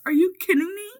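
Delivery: high, indignant outburst; upset Sweetie. Retold or imitated. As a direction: act out being outraged and incredulous. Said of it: "Sweetie's very upset".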